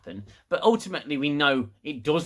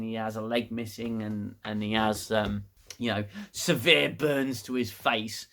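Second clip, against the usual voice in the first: ordinary delivery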